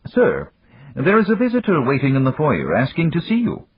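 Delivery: high-pitched butler announcement; robot butler. Acted. Robot butler tells his master a visitor is waiting, high formal announcement.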